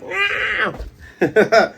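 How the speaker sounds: very high drawn-out yelp; mock scream of a crew under attack; imitated scream. Imitating frightened pirates.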